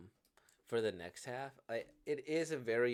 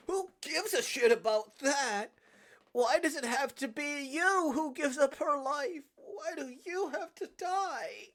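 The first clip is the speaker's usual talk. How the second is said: high, loud, tearful protest; upset shouting close to tears; anguished outburst; 11 semitones higher, 8 dB louder than usual. Acted. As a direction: yell in despair, dragging out the end. Acted anguished outburst at sacrifice.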